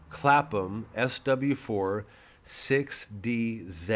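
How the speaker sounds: very slow, deliberate dictation; dictating slowly. Shown as a demonstration. Dictating the postcode to viewers, slow. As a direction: lay out each letter for them. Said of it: "You writing this down?".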